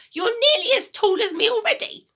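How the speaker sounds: put-on high, loud, bossy old woman; a mean grandma giving orders, high and loud; grumpy grandma voice. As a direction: nag the boy like a sour old granny. Grandma scolds.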